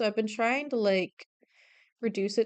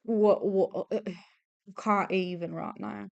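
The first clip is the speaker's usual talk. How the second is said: put-on upset Cockney Devon accent, lightly done; faltering Cockney Devon imitation; Honora's Cockney Devon accent. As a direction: slip into a rough go at Cockney Devon. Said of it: "her Cockney Devon accent".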